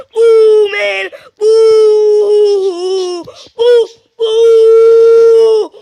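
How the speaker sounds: loud, high drawn-out howls; pretend fight yells. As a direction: wail each hit out in mock battle.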